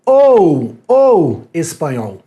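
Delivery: "ou" hit and stretched out slowly; slow, drawn-out "ou espanhol"; stressed "ou". Shown as a demonstration. Teacher showing the mistaken word in the ad, slow and stressed.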